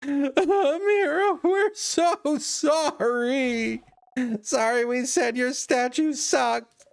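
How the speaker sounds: high, sobbing and slow apology; crying apology. Acted. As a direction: plead in a teary high voice. Voicing apology between characters.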